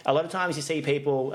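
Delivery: ordinary talk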